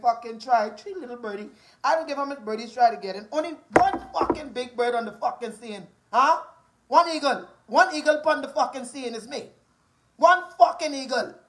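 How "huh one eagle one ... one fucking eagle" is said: ordinary delivery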